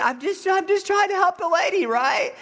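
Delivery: very high, pleading mock protest; exasperated protest. Helper defending himself to yelling woman, exasperated protest.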